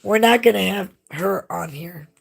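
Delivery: loud, emphatic ultimatum; fed up. Real, not acted. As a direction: lay down the law loudly. Co-host hits back at the teasing.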